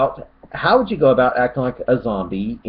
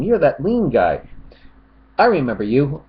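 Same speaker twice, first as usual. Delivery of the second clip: ordinary talk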